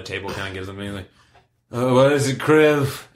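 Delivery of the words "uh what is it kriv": put-on drunk patron voice. Drunk patron calls to bartender.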